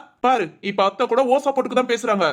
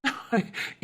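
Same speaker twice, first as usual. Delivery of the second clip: soft laugh; 10 dB quieter than usual. Real, not acted. Husband laughs.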